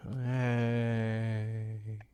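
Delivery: low, quiet, drawn-out deadpan; limp, unimpressed mock cheer; sarcastic cheer. Real, not acted. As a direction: celebrate with zero enthusiasm.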